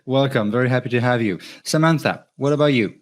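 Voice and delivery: ordinary talk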